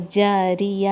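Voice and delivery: جاریہ with the chin dropped and mouth open, light ra with kasra; correct pronunciation. Shown as a demonstration. Teacher models the correct جاریہ for her students, open-mouthed. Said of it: "آپ کی چن drop ہوگی اور کھلے منہ کے ساتھ".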